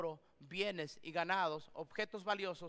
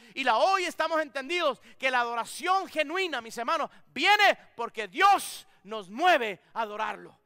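louder, higher fervent preaching; full-force preaching; 4 semitones higher, 11 dB louder than usual. Acted. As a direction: build the congregation up with conviction. Preaching climax rises in force. (predicación con toda fuerza)

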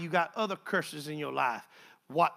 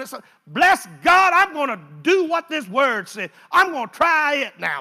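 loud, high preaching shout; full-force preaching; 5 semitones higher, 9 dB louder than usual. Acted. Preacher rallying the church, shouting high and loud.

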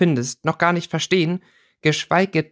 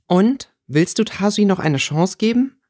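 higher, lightly questioning lilt; careful probing; 8 semitones higher than usual. A narrator's reading. Theresa sounding out Winona about Tasi, cautiously. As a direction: probe gently with a raised voice. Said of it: "fragte Theresa mit einem vorsichtigen Seitenblick".

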